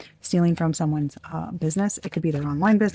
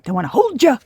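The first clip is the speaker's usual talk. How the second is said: higher, louder playful quote of her catchphrase; imitating Tarot Doc; 5 semitones higher, 6 dB louder than usual. Reader imitates the YouTube tarot reader's catchphrase, louder and higher. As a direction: mimic her fond sing-song line, pitched up and louder. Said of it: "cracks me up every time she says it like that".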